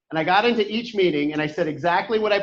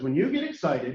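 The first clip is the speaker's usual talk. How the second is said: softer, as if common knowledge; low-key and obvious, lower in pitch; matter-of-fact; 4 semitones lower, 6 dB quieter than usual. Retold or imitated. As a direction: state it like everybody knows. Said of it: "he just said it like everybody knew this".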